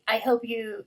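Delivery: ordinary talk, nothing marked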